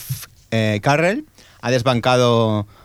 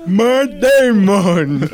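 drawn-out, much higher, louder "Matt Damon!"; catchphrase shout; 8 semitones higher, 5 dB louder, much slower than usual. Pretend. Co-host punching out the show's running-gag name, slow, loud shout. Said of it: "eso tiene mucha fuerza".

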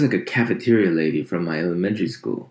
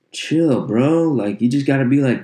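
higher, louder pushback at dad; exasperated retort; 7 semitones higher, 5 dB louder than usual. Acted. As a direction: blurt it out, high and exasperated. Acted retort to dad on phone.

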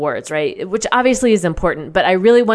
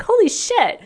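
shocked; slower than usual. Retold or imitated. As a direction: voice jumps way up in disbelief. Shocked on joining fluent class.

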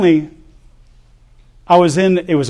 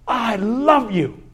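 tender dad; 6 semitones higher, much slower than usual. Retold or imitated. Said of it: "and he goes".

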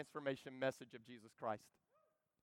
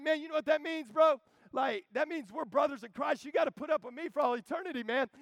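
higher, louder, thrilled; excited buddy; 9 semitones higher, 6 dB louder than usual. Retold or imitated. Preacher telling his new brother in Christ, excited. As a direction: gush like a happy friend. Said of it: "It's like".

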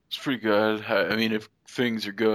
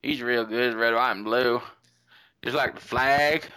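drawn-out put-on Australian accent; mock Australian accent. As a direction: play a cartoon Australian reader. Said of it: "I started doing it in Australia".

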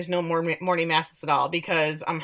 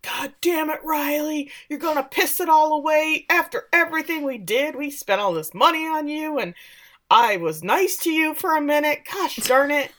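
high, raised-voice ranting dad; mock angry dad; 8 semitones higher than usual. Recapping, friend imitates Dad ranting at Riley in mock anger. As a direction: ham up a furious father scolding. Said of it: "Dad is instantly pissed".

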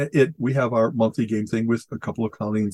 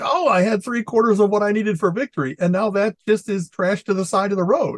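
higher-pitched exasperated complaint; frustrated player; 8 semitones higher than usual. Acted. Planner whose winning cards just got trashed, frustrated. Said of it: "it's really frustrating You're like".

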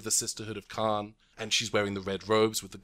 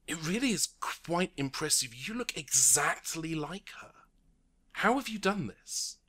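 lightly voiced official, higher and wondering; impressed; 7 semitones higher than usual. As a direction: marvel at her face. Karai amazed.

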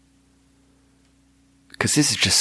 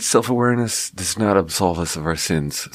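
ordinary talk

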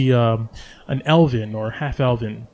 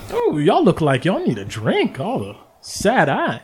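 higher, folksy y'all barkeep; lightly voiced cheerful server, slow and drawn out; a hint of a chirpy tavern girl; bubbly barkeep Bonnie; 6 semitones higher, slower than usual. Barkeep Bonnie greets the party.

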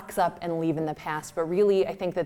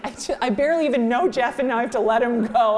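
put-on higher, louder, hurried child's wail; bawling child; 5 semitones higher, 5 dB louder, faster than usual. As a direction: imitate a kid bawling over a lost pet. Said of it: "it's bawling".

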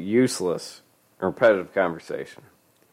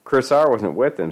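usual speaking voice

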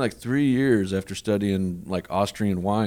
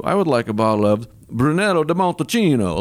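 hammed-up Italian accent, a bit higher; mock Italian accent; 5 semitones higher than usual. Shown as a demonstration. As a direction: order wine like a fake Italian sommelier. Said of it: "as opposed to".

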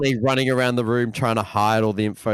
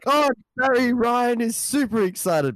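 excited commentator; 12 semitones higher than usual. Retold or imitated. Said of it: "And then he's like".

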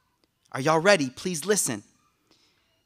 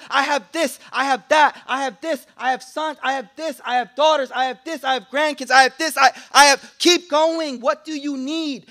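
rising fervor; 4 semitones higher, 6 dB louder than usual. Acted. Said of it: "building list".

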